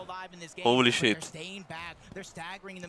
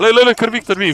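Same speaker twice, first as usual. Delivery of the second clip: excited; 9 semitones higher, 6 dB louder than usual. Real, not acted. Hyped reaction to the stream.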